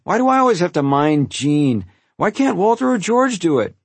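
high, loud protest climbing in pitch; worked-up child's whine; whining complaint. A narrator's reading. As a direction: complain at the unfairness. Child complains about minding Gene.

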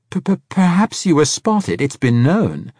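high, loud nervous stutter; stammering old man. A narrator's reading. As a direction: stammer a shifty excuse.

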